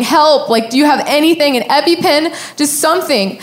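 high, pleading mock cry for help; retold yell. Runner begging an elderly couple for an EpiPen, retold yell. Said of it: "I just remember yelling".

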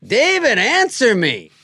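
high, loud mock yell; hammed-up impatient shout for a response; demanding shout. Comedian acting friend's three a.m. message, demanding shout.

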